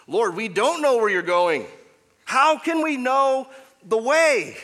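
Thomas asking. Retold or imitated. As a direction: voice a bewildered disciple pleading. Thomas voiced asking Jesus.

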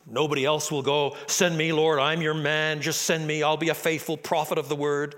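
loud, bold, eager volunteer; heroic Isaiah. Retold or imitated. Mocking how songs make Isaiah a loud bold hero. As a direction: puff up like the hero who alone will go. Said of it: "make it sound as if Isaiah is, is heroic".

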